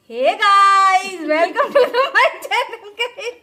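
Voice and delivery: बहुत ऊँची, ज़ोरदार, खींची हुई चैनल वाली ग्रीटिंग; हँसते हुए व्लॉगर बनकर स्वागत करो; यूट्यूबर की नकल. Pretend. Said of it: "वो बोलेंगे". (भविष्य के बच्चों की तरह हँसते हुए ज़ोरदार व्लॉग स्वागत)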